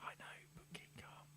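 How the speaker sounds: soft whisper. Real, not acted. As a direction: keep it hushed. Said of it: "why are we whispering that now".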